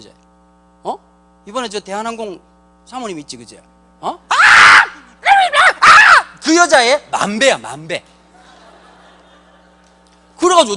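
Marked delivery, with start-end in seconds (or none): 4.3-6.4 high, loud cry of outrage; outraged shout. Real, not acted. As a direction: let out a sudden shriek. Shout at the thought. (높고 크게 터지는 비명)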